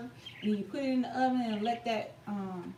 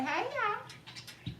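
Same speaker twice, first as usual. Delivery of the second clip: signature greeting; 10 semitones higher, 4 dB quieter than usual. Shown as a demonstration. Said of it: "we all say".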